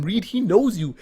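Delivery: thrilled disbelief; excited shout. Retold or imitated. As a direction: blurt it out.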